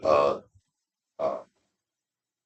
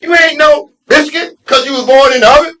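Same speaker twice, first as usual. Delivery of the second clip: high, loud pep talk; self pep talk; 5 dB louder than usual. Retold or imitated. Telling himself off about the convention, loud and high. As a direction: shout yourself back to sense. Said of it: "I had to tell myself".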